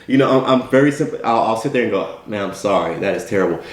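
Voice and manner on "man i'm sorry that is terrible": low, subdued condolence, lightly acted; perfunctory sympathy. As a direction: offer a brief, lowered-voice commiseration. Acts brief sympathy before changing subject.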